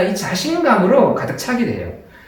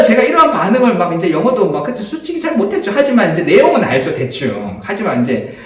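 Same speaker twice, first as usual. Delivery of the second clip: ordinary delivery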